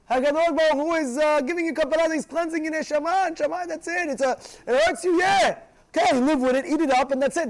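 forceful, high-pitched telling-off; insistent admonishing. Acted. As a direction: press the point loudly at someone panicking. Acted admonishing of upset man.

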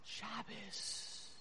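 very soft, voiceless whisper; retold whisper. Grandmother into granddaughter's ear, whispering. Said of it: "I whispered in my granddaughter's ear".

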